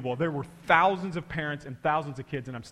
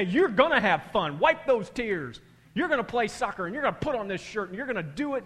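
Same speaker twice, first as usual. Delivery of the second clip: insistent parent; 7 semitones higher, 7 dB louder than usual. Retold or imitated. Parent forcing kid to play.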